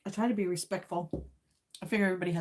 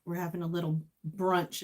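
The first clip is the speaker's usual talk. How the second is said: normal talking voice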